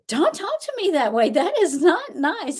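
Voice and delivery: put-on high, childish scolding voice, slowing at the end; imitating a toddler. Grandma mimics her grandson's high scolding voice. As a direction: play a three-year-old telling off his Nana. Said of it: "He's like".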